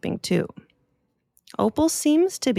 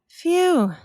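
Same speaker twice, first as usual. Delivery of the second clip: high little sigh of relief; relieved phew; 10 semitones higher, 4 dB louder than usual. Acted. Opal relieved the bat is not mad. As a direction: let the worry out in one breath.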